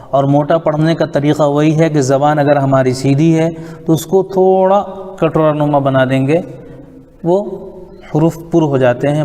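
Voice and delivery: ordinary delivery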